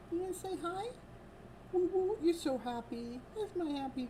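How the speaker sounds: very high, cooing pet voice. Real, not acted. Owner greeting her dog Naya on camera, very high cooing. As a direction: squeal sweetly at the puppy.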